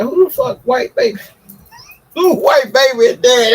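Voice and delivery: high, loud, laughing disbelief; shocked yell. Retold or imitated. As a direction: yell it at the doctor, half laughing. Retelling yelling at the doctor.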